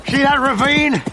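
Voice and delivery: loud, high call over the hooves; calling out on horseback. Acted. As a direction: shout across to him while riding. Calling out while riding.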